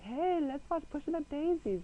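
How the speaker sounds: high, bright mock friend voice; lightly voiced friend suggestion; imitating friends. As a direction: toss it off like an eager friend.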